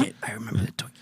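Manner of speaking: whispered reply; hushed agreement. Real, not acted. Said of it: "Just tell him you do".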